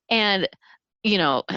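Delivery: normal talking voice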